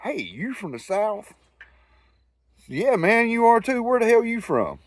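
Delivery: a hint of a high, excited Southern stranger; two Southerners meeting abroad, mildly hammed-up; Southern guy. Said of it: "Guy heard my accent, walks over".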